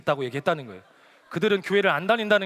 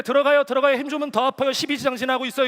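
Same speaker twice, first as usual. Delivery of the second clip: urgent doctor; 6 semitones higher, 6 dB louder than usual. Retold or imitated. 의사가 내시경 중 다급히 하는 말.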